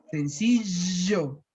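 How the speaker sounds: slow Sencillo with a strong sh-like ll, Argentine style; Argentine accent. Shown as a demonstration. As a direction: drag it out the way Argentines say it. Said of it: "en Argentina lo pronuncian muy fuerte". (sencillo con acento argentino, ll fuerte)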